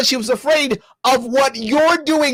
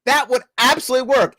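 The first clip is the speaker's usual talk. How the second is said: ordinary talk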